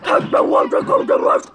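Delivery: high, rough caveman grunt-talk. Pretend. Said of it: "act like a caveman".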